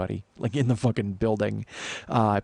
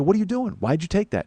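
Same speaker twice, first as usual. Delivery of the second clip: high-pitched, indignant demand; retold angry challenge; 8 semitones higher than usual. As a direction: reenact confronting him over it. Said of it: "I was fucking mad".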